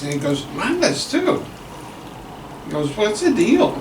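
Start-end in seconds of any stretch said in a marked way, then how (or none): 0.6-1.4 second horse in a much higher voice. Acted. 2.9-3.8 a much higher, puzzled horse voice. Acted.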